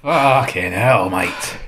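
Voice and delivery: slow, low worn-out groan; drained groan. Real, not acted. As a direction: sag into it, exhausted. Recovering from the sauce.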